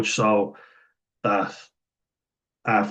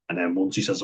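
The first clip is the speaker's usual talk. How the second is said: ordinary talk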